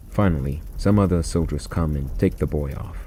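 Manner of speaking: ordinary delivery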